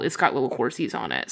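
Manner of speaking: normal talking voice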